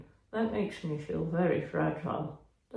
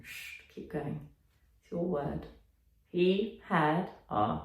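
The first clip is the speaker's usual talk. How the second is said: ordinary talk, nothing marked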